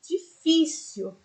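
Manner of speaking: difícil said slowly with the fí syllable strongest; stress on fí. Shown as a demonstration. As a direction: drag difícil out and hit the fí. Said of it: "o fi tem um som muito mais forte".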